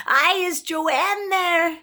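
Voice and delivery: high, drawn-out Scottish prank-caller voice; mock Scottish accent. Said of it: "anybody can put on a Scottish accent".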